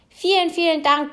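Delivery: hoch, laut und überschwänglich; übermütig blubbernd. A narrator's reading. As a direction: sprudelnd vor Freude danken. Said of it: "blubberte der kleine blaue Fisch übermütig". (übermütig und hoch blubbernd)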